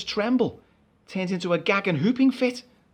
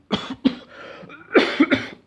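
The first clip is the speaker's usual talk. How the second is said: nervous cough; 7 semitones higher, 4 dB louder than usual. Acted. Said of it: "a cough of the nervous variety".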